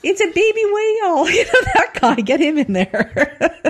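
joking Massachusetts voice, cracking up; mock Boston accent, slow on the last words. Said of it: "Massachusetts accent".

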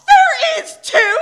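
loud high gasp then shout. Acted. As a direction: gasp and yell it back. Gasping protest for the Fog.

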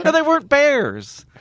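high, slow protest; mock indignant. Offended Ewok actor corrects a passer-by, high mock indignation.

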